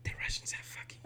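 hushed whisper about politics. Real, not acted. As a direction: whisper it so nobody hears. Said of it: "why are you whispering again?".

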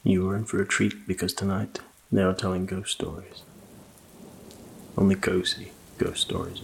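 plain reading voice

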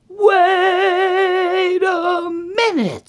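high, loud, hugely drawn-out outcry; alarmed Papa Bear. Acted. Papa Bear finds his porridge disturbed, long loud cry. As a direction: stretch the shocked cry right out. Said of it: "says Papa Bear".

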